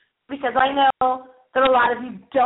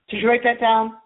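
normal talking voice

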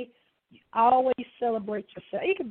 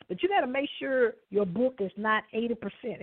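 ordinary talk

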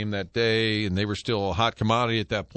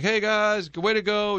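higher, excited reporter call across the tarmac; reporter yelling; 8 semitones higher, slower than usual. Retold or imitated. Host retelling a reporter yelling to the team, mock yell. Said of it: "she's yelling".

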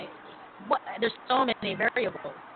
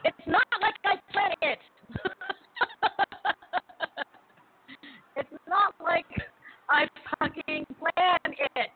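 laughing; 8 semitones higher than usual. Real, not acted. Laughing at mishap.

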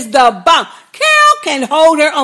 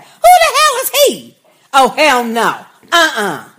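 indignant. Real, not acted. Indignant outburst about the character.

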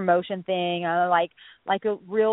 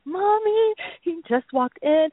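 high, louder whimpering cry; crying to mom; 8 semitones higher, 4 dB louder than usual. Retold or imitated. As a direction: wail like a panicked child to mommy. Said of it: "calling my mommy".

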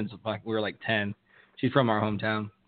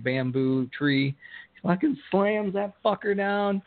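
usual speaking voice

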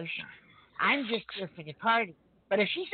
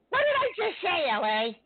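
higher, louder exasperated repeat; exasperated yell; 9 semitones higher, 3 dB louder than usual. Acted. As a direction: shout it at him, fed up.

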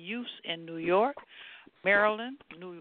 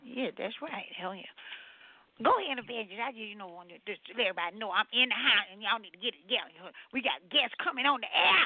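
Sister Peppercorn; 4 dB louder than usual. Acted. Sister Peppercorn keeps talking.

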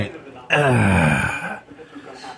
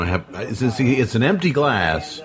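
ordinary talk

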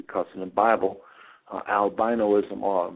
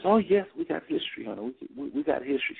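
higher-pitched, eager quote of a librarian; excited librarian; 7 semitones higher than usual. Retold or imitated. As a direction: imitate her excited welcome, pitch jumping up. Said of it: "She was very excited".

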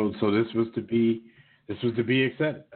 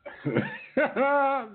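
high burst of laughter; 13 semitones higher than usual. Real, not acted. Laughs at quote.

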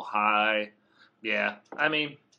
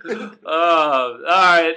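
slow drawl; 8 dB louder, much slower than usual. Real, not acted. Drawn out recovery.

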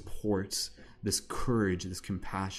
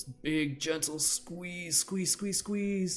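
high cooing squeeze; 10 semitones higher, much slower than usual. Acted. Guiding a self-hug.

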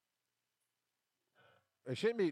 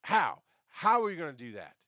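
retold challenge; 3 semitones higher, 7 dB louder than usual. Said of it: "bam, with the truth".

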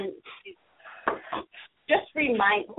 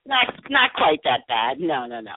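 ordinary delivery

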